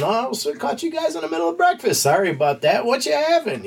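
sweet, high-pitched fake friendliness; imitating Samuel Jackson all smiles and charm; mock charming Jules. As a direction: play the charming hitman, pitch way up.

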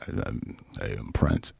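very low, murmured one-note voice. Retold or imitated. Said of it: "I can't even do it as monotone".

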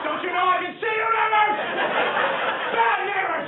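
comic owner bellowing at the dog through the walls; yelling at the dog. Retold or imitated. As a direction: holler at the dog so the neighbors hear. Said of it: "every time I yell at my dog".

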